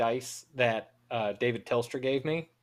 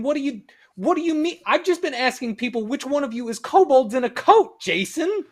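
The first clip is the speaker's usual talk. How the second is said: rising, much higher and louder disbelief; exasperated rant; 10 semitones higher, 5 dB louder than usual. Acted. Jean ranting at Jason over his easy answer, exasperated and rising. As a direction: build from frustrated to squeaky outrage. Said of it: "looking at her and goes".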